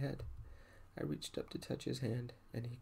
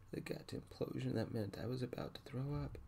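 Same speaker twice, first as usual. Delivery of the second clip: usual speaking voice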